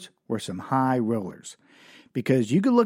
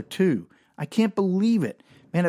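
usual speaking voice

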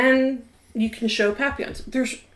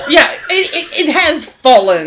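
laughing through the words; can't stop giggling; 6 semitones higher, 10 dB louder than usual. Real, not acted. Laughing at the Felin/fallen pun.

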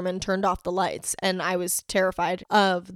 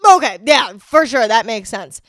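sarcasm; 8 dB louder than usual. Real, not acted. Sarcastic agreement.

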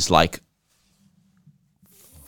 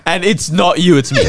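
put-on caught-out boyfriend; 6 semitones higher, 3 dB louder, slower than usual. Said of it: "sound like a boyfriend that got caught in a lie".

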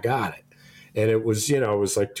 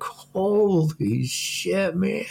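shocked disbelief; 12 semitones higher, much slower than usual. Retold or imitated. Retelling shock at a barracuda bite.

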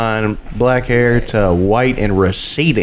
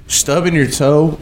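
usual speaking voice

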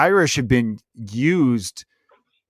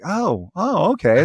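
acted penny-drop moment, higher first then settling; dawning realization; 4 semitones higher, slower than usual. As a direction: play suddenly figuring it out. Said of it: "that's how you're like".

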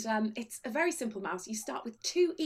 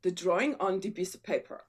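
put-on hesitant, low Egyptian-accented waiter; halting and low, imitating an Egyptian accent; 6 semitones lower than usual. Waiter asking a husband about a drawing, imitated Egyptian accent. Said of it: "I think he's Egyptian".

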